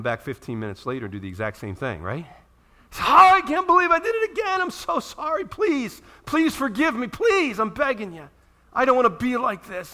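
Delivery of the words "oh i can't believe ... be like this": much higher, loud, pleading mock wail; hammed-up desperate plea, pitched way up; whiny begging. Preacher voicing the repeat offender begging forgiveness.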